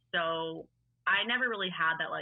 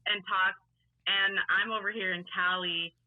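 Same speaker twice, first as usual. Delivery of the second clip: usual speaking voice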